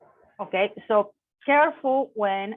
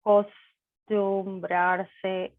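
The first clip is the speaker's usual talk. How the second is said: slow model; much slower than usual. Shown as a demonstration. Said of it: "teacher repeats verb slowly".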